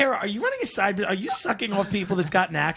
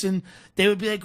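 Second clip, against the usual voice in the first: ordinary talk, nothing marked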